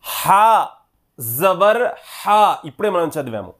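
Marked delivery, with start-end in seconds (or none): 0.0-2.4 slow drawn-out syllable with a rising end; qaida recitation. Shown as a demonstration. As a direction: chant it so the class can follow. Teacher recites letter drill. (నెమ్మదిగా సాగదీసి పలకడం)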